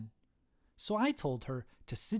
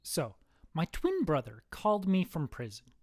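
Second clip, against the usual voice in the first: ordinary delivery, nothing marked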